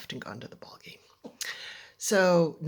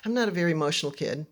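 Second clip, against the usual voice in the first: ordinary delivery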